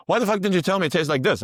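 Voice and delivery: high, loud mock outrage; indignant outburst. To the friend who never said chocolate tastes like this, mock outraged exclamation. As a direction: throw the complaint back in mock disbelief.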